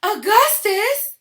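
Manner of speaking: long high shout of a name. Acted. Mother shouting her son's name. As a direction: call him back in alarm. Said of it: "shouted Missus Gloop".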